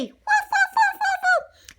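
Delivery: high joyful woofs; happy barking. Acted. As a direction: bark happily like a little dog. Lady barks happily.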